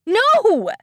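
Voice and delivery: loud, high anguished cry; shout. Acted. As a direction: scream out in dismay. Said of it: "Eda shouts".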